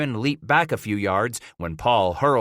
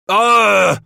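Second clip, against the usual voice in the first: loud, high furious cry; an enraged outburst; angry roar; 11 semitones higher, 11 dB louder than usual. Acted. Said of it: "Emile growled".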